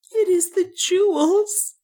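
very high, tearful woman's voice; sobbing, acted. A narrator's reading. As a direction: wail it as if sobbing. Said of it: "she sobbed".

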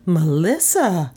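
drawn-out, mildly scandalized name; a touch of shock; shocked gasp. A narrator's reading. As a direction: gasp her name slowly in reproach. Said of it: "Beulah Porter Cowis gasped".

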